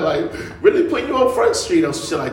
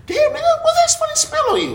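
very high-pitched retold disbelief; mock astonished question; 4 dB louder than usual. Nephew asking uncle about his odd smell, high mock astonishment. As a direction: squeal the question in comic surprise.

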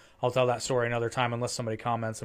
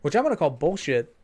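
ordinary talk

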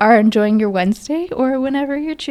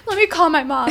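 usual speaking voice

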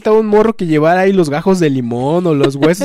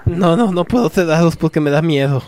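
joking whispered reply; mock whisper. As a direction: whisper back as a joke. (susurro de broma)

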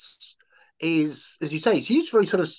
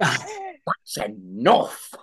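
slow firm line; 3 semitones lower, much slower than usual. Retold or imitated. Quoting again, slower.

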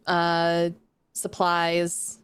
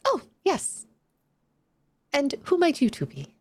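much higher, eerie creature voice; a gentle amphibian spirit, pitched way up; high fish-man voice; 7 semitones higher than usual. Acted.

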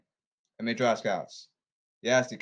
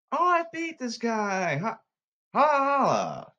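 high gloating gamer with a laugh; 9 semitones higher, slower than usual. Pretend. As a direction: brag and cackle over the win. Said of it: "Play a video game".

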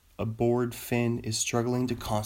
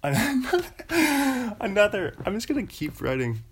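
high, loud squeaky dolphin laugh; Irish dolphin squeal; 10 semitones higher, 3 dB louder than usual. Pretend. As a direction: chirp like a giddy dolphin. Giggling dolphin squeal again.

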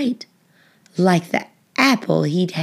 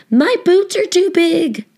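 much higher, louder put-out voice; complaining firefighter; 10 semitones higher, 4 dB louder than usual. A narrator's reading. Firefighter in oversized boots, high complaint. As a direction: squeak it like the boots swallow him. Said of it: "said another".

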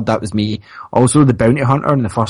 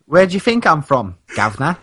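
higher-pitched put-on English accent; hammed-up English accent, pitched up; mock English accent; 5 semitones higher, faster than usual. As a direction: play up a jokey English "guv'nor" accent. Jokily playing English on being asked origin.

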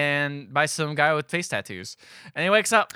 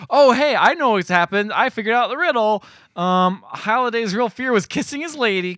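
louder, higher, sudden discovery; mock eureka; 8 semitones higher, 5 dB louder than usual. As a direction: blurt out the answer like a hammed-up detective. Quoting her sudden realisation.

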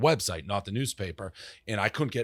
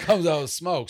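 rising, louder, half-laughing reveal; bam, like she's bursting out of the smoke; excited build-up; 7 semitones higher, 5 dB louder than usual. Retold or imitated. Telling the host about the Orange Bowl entrance, excited and laughing. Picturing a girl emerging from smoke.

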